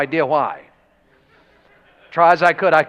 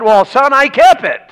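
higher, louder folksy dad voice; imitating his dad; 8 semitones higher, 6 dB louder than usual. Dad telling his son he kept the truck, high and folksy. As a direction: play the proud country dad.

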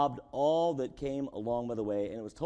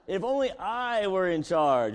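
loud wishful complaint; 7 semitones higher, 7 dB louder than usual. Retold or imitated. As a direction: belt out the wish, higher and louder. Preacher mimics wishful grumbler.

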